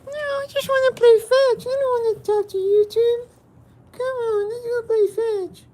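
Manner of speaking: a cartoon dog begging to go play; voicing the dog. Owner answering for his dog in an eager high-pitched puppy voice. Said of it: "you wanna say anything else?".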